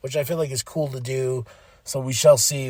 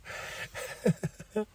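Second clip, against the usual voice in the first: airy squeaky giggle; a quick breathless laugh; high breathy laugh; 11 semitones higher, 10 dB quieter than usual. Real, not acted. Laughing after yelling at TV.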